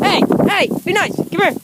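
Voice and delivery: high, loud call to the dogs. Real, not acted. Owner breaking up the dogs, high and loud.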